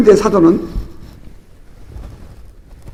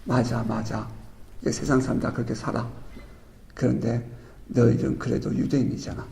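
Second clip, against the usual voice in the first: softer, low-key admonition; the apostle's gentle rebuke; 6 semitones lower, 6 dB quieter than usual. Retold or imitated. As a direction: chide them gently, almost confiding. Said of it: "사도가 굳이 여기서 이렇게 얘기하는 것은".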